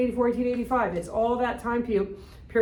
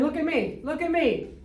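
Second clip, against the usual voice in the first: higher, insistent mock plea; lightly voiced demand to be looked at; Algerian speaking; 6 semitones higher than usual. Teacher voicing an Algerian asking the French to look at him, higher pitched. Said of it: "What do the people in Algeria say?".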